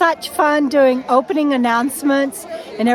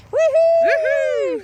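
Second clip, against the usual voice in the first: high, bright whoop of joy; excited cheer. Real, not acted. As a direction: cheer it out, thrilled. Cheering as meet and greet starts.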